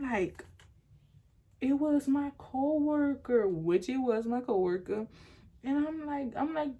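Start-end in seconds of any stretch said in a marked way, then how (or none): 1.6-3.5 higher, slow, drawn-out explanation; acted placating answer. Girlfriend explaining the text to boyfriend, acted placating. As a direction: answer him slowly and high, to placate.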